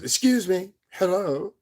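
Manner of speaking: high, loud mocking imitation of him; mock put-on voice. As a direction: pitch it way up, imitating his intrusive hello. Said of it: "busts up his voice and says".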